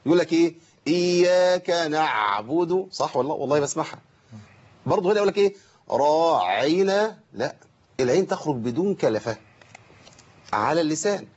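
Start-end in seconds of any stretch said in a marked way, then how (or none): 0.9-2.9 عين "نعبد" مضغوطة بمبالغة; بالغ في إخراج العين في "نعبد"; overstressed ain. Retold or imitated. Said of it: "بتبالغ في حرف العين". 5.9-7.2 عين "راعنا" مضغوطة ممدودة بكلفة; اضغط العين في "راعنا" بمبالغة; overstressed ain. Retold or imitated. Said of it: "بتبالغ في حرف العين".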